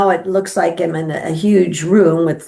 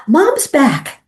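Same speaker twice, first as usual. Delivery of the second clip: high-pitched gasp of news; astonished, high-pitched call to the family; retold excited announcement; 9 semitones higher than usual. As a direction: announce it high, as to a whole room. Daughter's cry as mother wakes.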